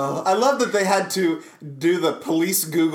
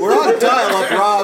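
raised, louder voice, playfully exasperated; mock exasperated yell; 4 semitones higher, 5 dB louder than usual. Officer to Rob about the slow connection, mock exasperated yell. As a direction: scold a colleague in mock exasperation.

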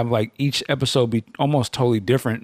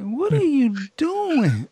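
high, slow, drawn-out disbelief; stunned what; 12 semitones higher, much slower than usual. Retold or imitated. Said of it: "It's like".

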